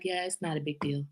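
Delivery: soft, low, unbothered mom shrug; relaxed, hushed letting-it-go voice; easygoing pandemic parenting. Acted. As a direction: brush it off quietly as no big deal. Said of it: "Pandemic parenting is like".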